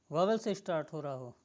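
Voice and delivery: usual speaking voice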